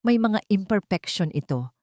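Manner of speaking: ordinary delivery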